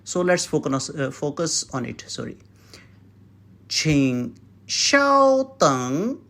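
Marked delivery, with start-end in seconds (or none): 3.7-6.2 请稍等 drawn out slowly; slow model phrase. Shown as a demonstration. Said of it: "means wait for a while".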